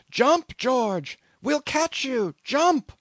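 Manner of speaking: high, urgent scream; screamed urging. A narrator's reading. Men in the boat urge their shipmate to jump, screaming. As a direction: scream up to him, pitched very high. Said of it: "they screamed".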